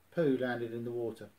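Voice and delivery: usual speaking voice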